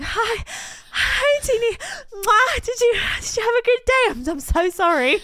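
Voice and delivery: high, hurried apologetic gasping greeting; mock breathless boyfriend. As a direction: play him panting and eager to please. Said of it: "he was like".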